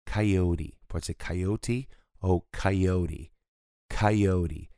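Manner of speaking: coyote as kai-o-di, flapped T; coyote pulled to A. Shown as a demonstration. Teacher showing an American pronunciation. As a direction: pull coyote toward an A sound. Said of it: "É como se fosse puxando pro A".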